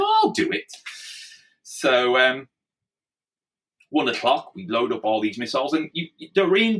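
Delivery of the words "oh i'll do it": high-pitched, eager offer; re-enacted eager yes; keen volunteer. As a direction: pipe up keenly, much higher than usual. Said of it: "but I was keen".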